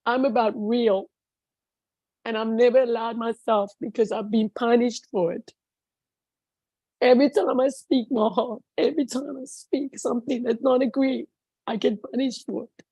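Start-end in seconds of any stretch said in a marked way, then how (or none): none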